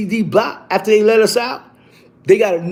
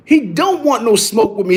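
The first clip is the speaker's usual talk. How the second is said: normal talking voice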